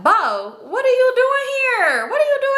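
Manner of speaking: very high, drawn-out squealed greeting; excited squeal. Retold or imitated. Bartender friend spots a man she knows at work, excited squeal. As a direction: gush it in a stretched-out, high-pitched squeal.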